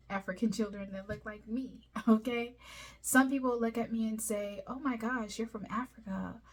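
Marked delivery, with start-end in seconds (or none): none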